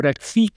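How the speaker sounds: "Krieg" with a German vowel and a final g. Shown as a demonstration. A linguist showing a variant pronunciation of "Krieg". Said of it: "integrate it more into German syllable structure".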